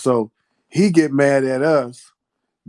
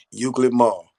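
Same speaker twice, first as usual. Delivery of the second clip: ordinary talk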